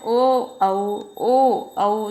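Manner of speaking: ओ and औ sounded one by one; throat-and-lip vowels. Shown as a demonstration. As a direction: show rounded ओ, then the glide औ, each separately. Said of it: "कंठ से और ओष्ठ से". (कंठ और ओष्ठ से ओ, औ का उच्चारण)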